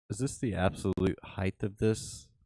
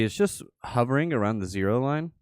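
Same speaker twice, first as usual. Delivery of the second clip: normal talking voice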